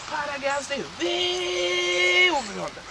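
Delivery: high, long-stretched WAY; slow, sing-out "way over yonder"; drawn-out distance. Acted. Son tells how far away she lives.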